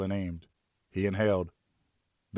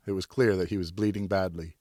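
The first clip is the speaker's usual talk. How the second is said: ordinary delivery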